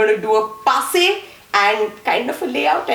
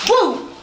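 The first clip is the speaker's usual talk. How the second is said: high-pitched whoop on the beat; short, raised "woo"; 9 semitones higher than usual. Shown as a demonstration. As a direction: punctuate the move with a woo. Vocal cue for the move.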